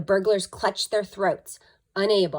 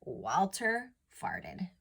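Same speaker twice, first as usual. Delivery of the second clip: usual speaking voice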